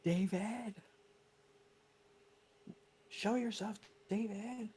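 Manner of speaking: put-on Lost Boys movie quote. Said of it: "that's The Lost Boys".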